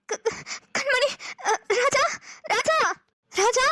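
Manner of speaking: put-on stammering, tearful call for the missing girl; a choked, slowed cry of her name; panicked crying call. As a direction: sister calling out in tears for the missing girl. Panicked sister calling, crying.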